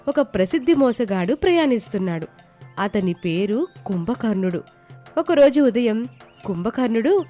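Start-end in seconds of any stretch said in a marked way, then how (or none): none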